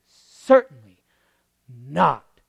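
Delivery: loud, slow Certainly not; emphatic preaching; Paul's loud proclamation. Acted. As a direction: thunder the answer. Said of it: "Paul says".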